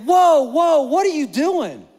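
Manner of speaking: a coach halting practice, slowing on the question; coach stopping the drill. Retold or imitated. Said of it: "Coach Rain's like".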